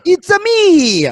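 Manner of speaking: very high, loud, stretched-out cartoon Italian accent; hammed-up Italian accent, slow and high. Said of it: "anybody in a stereotypical Italian accent is hysterical".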